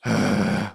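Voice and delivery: low rumbling sigh from the throat; deep heavy sigh. Shown as a demonstration. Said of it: "when we sigh".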